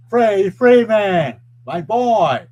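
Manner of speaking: loud, high drawn-out fan yell; cheering. Real, not acted. Fan cheering on Freddie Freeman, proud shout. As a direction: holler the player's name with pride.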